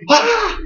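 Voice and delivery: high mock cry of alarm; shocked yelp. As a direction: let out a startled parental shriek. Shocked parent hearing doubt.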